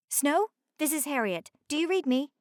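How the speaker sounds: a helicopter pilot checking in, lightly played; radio call to the icebreaker, lightly voiced. A narrator's reading. Helicopter calls over radio.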